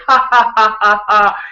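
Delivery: high, loud shared laughter; all three laughing together; group laugh imitated. Dad and two boys laughing while walking, loud laugh. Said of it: "we're all just like".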